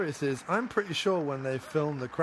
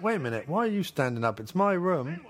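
mock office boss; 7 semitones higher, faster than usual. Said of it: "He's like".

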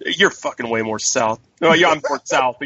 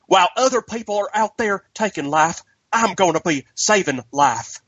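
over-the-top fake Southern accent, pitched up; Andrew Garfield impression; 6 semitones higher than usual. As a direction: lay on a ridiculous Southern twang. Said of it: "that over the top, like, ridiculous Southern accent".

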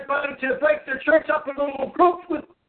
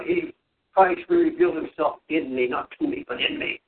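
preaching; 10 semitones lower than usual. Real, not acted. Preaching at full force.

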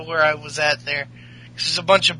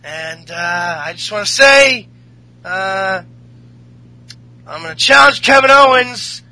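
loud, raised ring announcement; wrestler's promo, halting but punched up; retold wrestling announcement; 4 semitones higher, 6 dB louder than usual. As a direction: lay down a challenge to the crowd. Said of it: "he's like".

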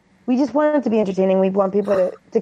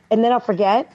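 usual speaking voice

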